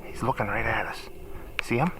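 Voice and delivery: low hushed voice; wildlife watching whisper. Real, not acted. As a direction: keep it down, he's watching. Hunters watching buck quietly.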